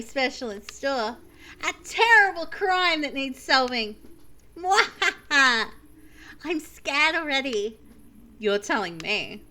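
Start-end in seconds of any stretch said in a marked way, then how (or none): 4.6-5.7 slow theatrical villain laugh; wild unhinged ranting. Acted. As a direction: cackle like a cartoon villain. Announcing a terrible crime.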